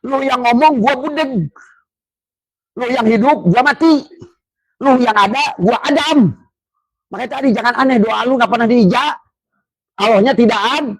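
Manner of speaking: high teasing voice for God's reply; voicing God. Preacher voicing God's retort to the congregation, high and teasing. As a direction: answer in a high, mocking street tone. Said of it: "Padahal kata Allah".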